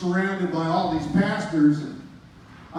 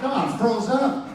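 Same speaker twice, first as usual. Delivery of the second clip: laughing; 6 semitones higher, 3 dB louder than usual. Real, not acted. Laughing while retelling.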